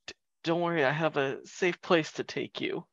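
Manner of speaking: calm the wounded man down gently; soft reassurance. Acted.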